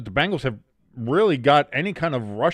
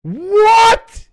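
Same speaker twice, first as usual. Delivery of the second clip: high, loud disbelieving what; real stunned yelp; shocked outburst; 11 dB louder than usual. Real, not acted. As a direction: blurt it out at a bad call. Reacting to a call.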